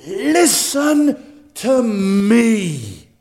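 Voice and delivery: high, very slow drawn-out call, shouted at the peak; God calling. Acted. God calling people among competing voices, slow pleading call. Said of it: "he says".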